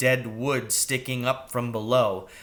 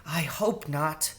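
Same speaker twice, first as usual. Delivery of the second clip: soft, slow mouse voice; timid mouse, softly; whispered mouse; 4 semitones higher, 5 dB quieter than usual. Acted. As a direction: hush it back. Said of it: "She whispered back".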